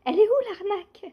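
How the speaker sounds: high, loud, fast and indignant; indignant outburst. Retold or imitated. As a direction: blurt it out like you've been cheated.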